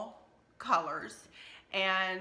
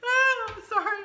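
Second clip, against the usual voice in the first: laughing; 9 dB louder than usual. Real, not acted. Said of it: "I snort when I laugh really loud".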